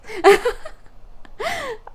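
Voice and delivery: high apologetic laughter. Real, not acted. Laughs at noisy neighbours.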